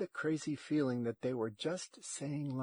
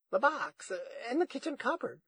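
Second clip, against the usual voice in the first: high, puzzled granny voice; high, quick, unsure grandmother; questioning grandma; 10 semitones higher, 4 dB louder, faster than usual. A narrator's reading. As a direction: say it like a question, pitched way up. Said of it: "She said it like a question".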